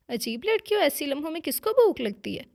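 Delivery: a put-out husband's half-cross complaint; mildly annoyed. Acted. Husband miffed that his wife is hungry now, mildly annoyed. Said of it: "वो खफा होने लगा".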